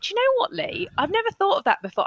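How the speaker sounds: high-pitched voicing of a sudden realisation; retold wonder, pitched well up; mock surprise. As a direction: act out a thought that has just struck you. Acts out own surprised thought.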